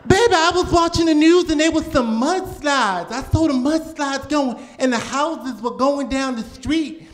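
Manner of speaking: high, fretful grandma voice; an anxious old grandmother on the phone; mock worried grandmother. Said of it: "she thinks it affects me".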